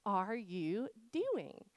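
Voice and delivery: exasperated, drawn-out question with a long pause after the first word; Paul. Retold or imitated. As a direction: ask it word by word, at the end of your patience. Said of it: "this is what Paul's tone is".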